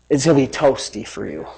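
low, soft gloating aside; dry taunt. Acted. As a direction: drop it quietly as a smug threat. Taunts devil with dry understatement.

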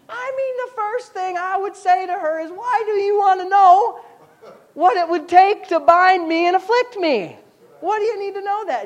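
high, earnest mock protest. Preacher acting Samson, high mock protest.

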